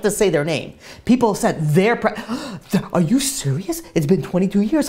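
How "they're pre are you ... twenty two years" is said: gasping, mildly incredulous reaction; higher, astonished townsperson with a gasp; acted disbelief. Townspeople hear of a pregnancy after twenty-two years, disbelieving.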